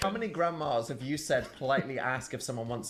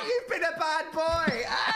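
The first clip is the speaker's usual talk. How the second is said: [name] voice; 14 semitones higher than usual. Pretend. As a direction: shriek it like an excited kid. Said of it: "You're doing the [name] version".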